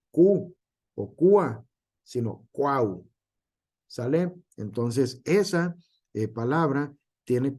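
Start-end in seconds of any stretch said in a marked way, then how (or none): 0.1-0.5 "cu" alone, stopping after the u; sílaba cortada. Shown as a demonstration. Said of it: "no le decimos". 1.1-1.6 "cua" louder, without the final u; sílaba incompleta. Shown as a demonstration. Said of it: "no le decimos". 2.5-3.1 "cuau" slow, all three vowels in one syllable; sílaba completa. Shown as a demonstration. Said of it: "así es lo correcto".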